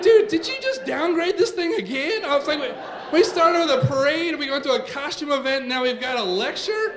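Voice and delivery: high, exasperated protest; hammed-up incredulous outrage; indignant complaint. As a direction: protest it like a cheated dad. Acted indignant complaint at downgrade.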